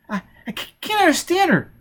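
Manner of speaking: put-on high, halting child's voice; high frightened child faltering; scared stammering child. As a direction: stumble over the words in fear.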